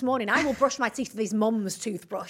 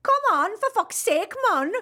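loud, high hammed-up Welsh accent; botched Welsh accent; 10 semitones higher, 5 dB louder than usual. Podcaster imitates a frustrated older Welsh man, botched Welsh accent. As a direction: attempt a strong Welsh accent, exaggerated and badly off. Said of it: "in a very strong Welsh accent".

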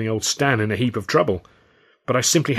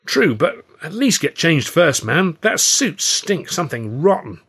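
slow, high, loud insisting; insistent friend; 7 semitones higher, 4 dB louder, slower than usual. A narrator's reading. Stan pleading slowly, disgusted by the smelly suit. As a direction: plead with him slowly to change.

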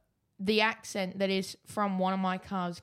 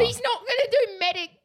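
laughing; 6 dB louder than usual. Real, not acted. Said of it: "laughing mid-sentence".

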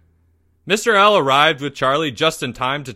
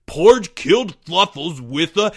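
louder, higher accusing cry; excited accusation; 4 semitones higher, 5 dB louder than usual. Acted. Greg blaming Porge before Leo cuts in, excited cry. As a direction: blurt out the accusation.

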